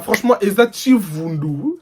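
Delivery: ordinary talk, nothing marked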